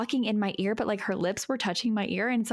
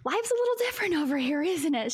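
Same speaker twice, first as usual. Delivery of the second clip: flustered reply; 12 semitones higher than usual. Retold or imitated. Younger self answering her teasing friend, flustered. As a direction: stall on a high um, then hurry out a pitched-up answer.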